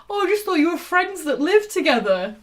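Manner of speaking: normal talking voice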